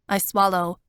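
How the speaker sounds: plain reading voice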